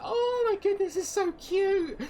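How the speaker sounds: very high squealing gush; high delighted child voice; cooing children. Acted. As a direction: squeal over something adorable. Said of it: "they're like".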